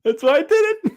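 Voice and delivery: high, gleeful teasing through a laugh; proud of the prank, half laughing; high laughing tease, pleased with himself. Real, not acted. Teasing after shocking the host.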